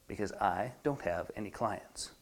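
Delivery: low, quiet, deflated admission; soft and sunken; inside voice. Acted. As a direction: drop to a private, sheepish confession. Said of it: "And the inside voice says".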